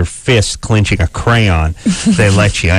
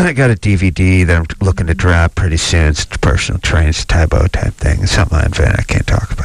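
deep mock big-shot voice; guy who got laid; 5 semitones lower than usual. Host still playing the newly laid caller bragging, deep voice. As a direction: keep it low and self-important. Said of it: "The voice goes down a couple of octaves".

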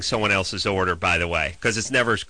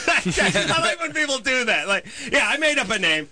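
high laughing mock voice; 12 semitones higher than usual.

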